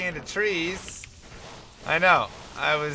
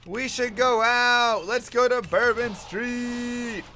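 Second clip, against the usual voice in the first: higher, slow wheedling party voice; mock eager friends; 6 semitones higher, slower than usual. Friends pleading to hit Bourbon Street, slow and high. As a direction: draw it out like tipsy friends begging.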